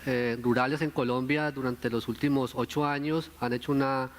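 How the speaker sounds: ordinary delivery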